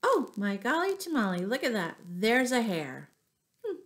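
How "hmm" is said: ordinary delivery, nothing marked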